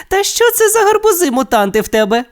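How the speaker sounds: very high, teasing character voice; mocking Alex. A narrator's reading. Alex teasing Steve about his pumpkins, mocking. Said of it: "насмішкуватою посмішкою".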